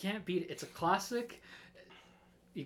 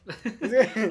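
sudden loud laugh; burst of laughter; 7 semitones higher, 13 dB louder than usual. Real, not acted. Cohost laughing at the British crisp remark. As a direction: laugh out at the joke.

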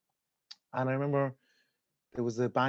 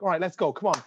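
louder, higher cocky bravado; eager to be arrested; taunting the officers; 6 semitones higher, 5 dB louder than usual. Retold or imitated. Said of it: "I'm like".

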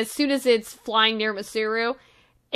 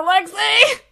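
anxious high call; 9 semitones higher, 4 dB louder than usual. Acted. Character cries out.

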